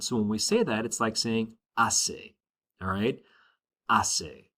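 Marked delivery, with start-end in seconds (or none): 0.9-2.3 hace said slowly "ah-say" with a silent H; model pronunciation. Shown as a demonstration. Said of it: "the H is silent". 3.9-4.5 hace said slowly "ah-say" with a silent H; model pronunciation. Shown as a demonstration. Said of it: "Try it with me".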